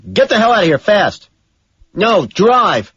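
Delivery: high, loud frantic order; screaming at the driver. A narrator's reading. Killer screaming at the cab driver to go, high and loud. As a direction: scream it at a startled cabbie to floor it. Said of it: "scream at its driver".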